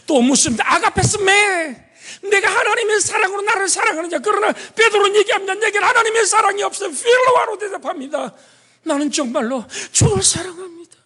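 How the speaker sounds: high, loud, fast impassioned preaching. Acted. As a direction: press the climax with tearful urgency. Preacher at peak intensity.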